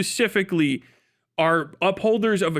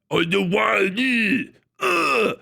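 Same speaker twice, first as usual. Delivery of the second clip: mock French accent ending in a high groan; French as if shot; 3 semitones higher, slower than usual. Shown as a demonstration. Streamer imitating the other French, groaning as if shot. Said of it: "literally just got shot".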